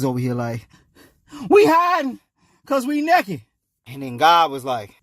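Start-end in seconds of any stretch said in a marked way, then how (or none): 1.5-3.4 high, loud panicky confession; scared. Acted. Adam hiding from God, scared. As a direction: blurt it out like you're caught. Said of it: "Now mans is all scared".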